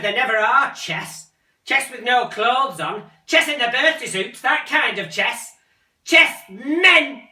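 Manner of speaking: loud, high, sneering; mocking rant. Mother jeering at son about magazines, loud. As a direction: jeer it at him.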